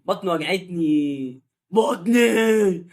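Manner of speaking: slow, hammed-up groaning of pain; mock stomach ache. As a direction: moan like your belly aches, overplayed. Fake stomach pain after food. (ألم بطن تمثيلي)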